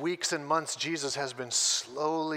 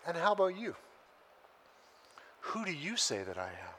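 Jesus asks quietly; 5 dB quieter than usual. Retold or imitated. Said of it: "then Jesus says".

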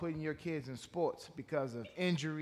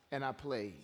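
usual speaking voice

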